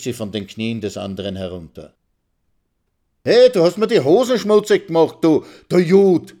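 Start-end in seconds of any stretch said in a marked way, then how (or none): 3.2-6.3 high, loud yelled insult; furious shout. Acted. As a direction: scream it at him. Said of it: "schreit Karl".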